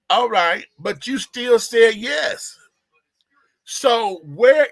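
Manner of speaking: high, loud and slow, driving the point home; emphatic shout. Real, not acted. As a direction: shout it out slowly, word by word. Arguing, hammering the point home.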